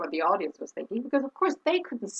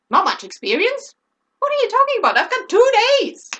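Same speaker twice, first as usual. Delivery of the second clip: high, loud mock outrage; indignant inner outburst; 10 semitones higher, 8 dB louder than usual. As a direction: protest loudly at a slight, pitch shooting up. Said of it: "I didn't say that out loud".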